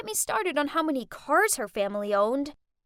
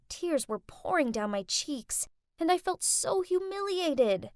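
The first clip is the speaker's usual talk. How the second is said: ordinary delivery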